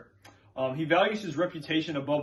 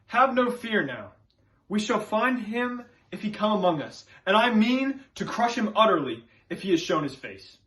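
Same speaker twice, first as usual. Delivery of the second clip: earnest, instructing preacher with raised pitch; Reverend Hale; 4 semitones higher than usual. Acted. Witchcraft expert instructs and questions the villagers, earnest authority. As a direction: lecture them with measured conviction. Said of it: "are from the character Reverend Hale".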